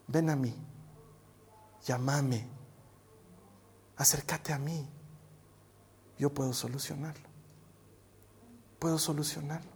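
very soft, low and unhurried; tender invitation. Acted. God calling the fallen to come, tender invitation. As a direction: draw them in gently, long pauses. Voicing God's gentle invitation.

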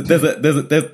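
ordinary talk, nothing marked

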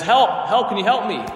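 high, loud, pleading shout; acted cries from a trapped man; calling for help. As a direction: call up to catch a passer-by. Trapped man calls to doctor.

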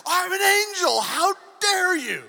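high, indignant shout; hammed-up outrage, yelled with stretched words; Gabriel, furious. Offended angel telling off Zechariah, furious mock shout. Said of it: "The angel gets furious".

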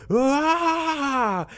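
a high, drawn-out sigh of relief; relieved sigh. Retold or imitated. Guard sighs with relief as help arrives, high and drawn out. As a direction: let out a long happy "ah". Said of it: "And then he goes".

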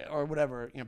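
normal talking voice

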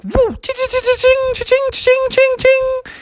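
very high ringing chimes; high cartoon cha-ching noises; collecting rings. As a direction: imitate rapid game pickup jingles. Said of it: "He captured all the golden rings".

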